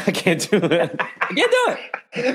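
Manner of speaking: giving up through real laughter; helpless laugh breaking into the words. Real, not acted. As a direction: crack up mid-attempt, voice climbing high. Gives up on accent, cracking up.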